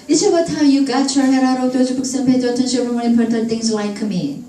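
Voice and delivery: level, unbroken stream from "It's about" to "like me"; run-together monotone, "got your head out of" blurred; long linked sentence. Shown as a demonstration. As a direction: rattle it off with every word linked through "like me". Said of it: "긴 문장 나오고 연음까지".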